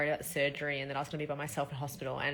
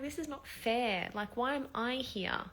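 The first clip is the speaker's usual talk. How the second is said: sad; 8 semitones higher than usual. Retold or imitated. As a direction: let it ache as you ask. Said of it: "I got really just sad".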